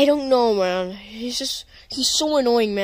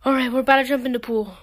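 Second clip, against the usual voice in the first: normal talking voice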